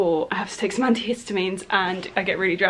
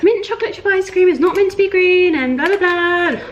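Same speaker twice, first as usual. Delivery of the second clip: high, loud mock-indignant voice; imitating people complaining about green ice cream, hammed-up and higher; mocking objectors; 10 semitones higher, 5 dB louder than usual. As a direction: parody a fussy critic, pitched way up. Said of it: "so many people out there are gonna be like".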